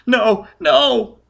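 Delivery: put-on high, loud frightened child voice; shrieking child. A narrator's reading. Scared child refusing Foyle's questions, shrieking high. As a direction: protest like a terrified little boy. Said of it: "The child was shrieking and shaking".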